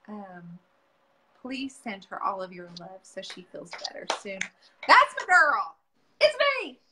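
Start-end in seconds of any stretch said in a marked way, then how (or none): 4.9-6.8 high, loud, delighted hello; excited greeting. Real, not acted. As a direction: squeal a welcome to a friend. Host greets guest coming on live.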